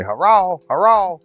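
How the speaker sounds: high, squawked parrot hello; parrot imitation. Parrot answering the phone, high imitated call. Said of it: "and it would say".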